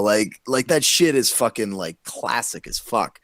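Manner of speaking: ordinary delivery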